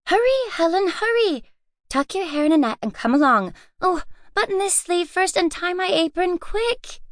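high, quick and pressing; urgent hurry. A narrator's reading. Louise hurrying Helen down to breakfast, urgent. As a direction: rush your sister out the door.

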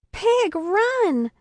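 high urgent cry of alarm; shouted warning. A narrator's reading. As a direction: yell out to warn her of danger. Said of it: "I shouted".

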